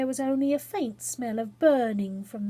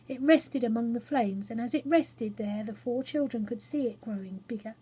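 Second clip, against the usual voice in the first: plain reading voice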